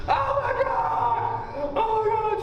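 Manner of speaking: high, slow terrified shriek of Oh my God; slow, high-pitched mock scream of panic; panicked scream. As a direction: imitate a woman screaming in terror, way up high. Donna panics at moving trays.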